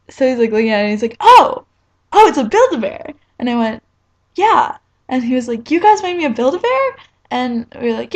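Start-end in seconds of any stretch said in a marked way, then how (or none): 1.2-3.1 surprised. Retold or imitated. AJ surprised by the gift. 4.3-4.8 excited. Retold or imitated. Eager answer to AJ. 5.7-7.0 surprised. Retold or imitated. AJ amazed at the gift.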